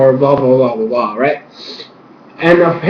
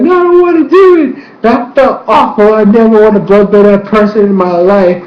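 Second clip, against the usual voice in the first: put-on high, whining little boy begging his dad; a boy refusing his father, near tears; pleading child; 5 semitones higher than usual. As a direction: beg like a scared kid who hated it. Young Tarlok pleading to his father.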